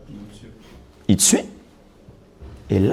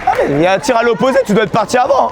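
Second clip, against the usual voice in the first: coach shouting; 8 semitones higher than usual. Real, not acted. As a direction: yell instructions across the court. Coach shouting during match.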